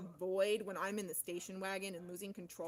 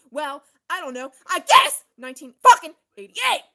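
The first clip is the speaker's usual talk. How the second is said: loud, high burst of a yell; sudden shout; 5 semitones higher, 22 dB louder than usual. Real, not acted. Telling viewers when she started calling it the Upside Down, sudden shout. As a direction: blurt the year out in a sudden holler.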